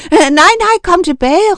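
high, loud cry for help; loud, high-pitched pleading yell; panicked shout. A narrator's reading. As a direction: call out desperately after someone. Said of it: "råbte de".

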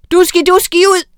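high, loud howled chant; howling spell. A narrator's reading. Witch howling a stronger spell, loud.

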